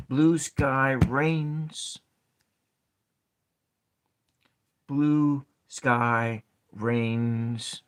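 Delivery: very slow, each word drawn out; slow sounding out. Shown as a demonstration. Teacher testing a haiku line for students, slow. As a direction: stretch every syllable to count it. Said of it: "seven syllables".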